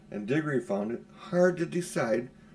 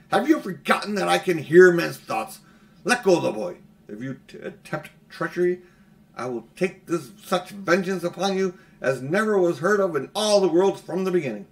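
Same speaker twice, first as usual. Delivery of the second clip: louder, higher furious witch; menacing loud threat; 5 semitones higher, 7 dB louder than usual. A narrator's reading. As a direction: threaten vengeance with full force. Witch threatens Uncle Andrew.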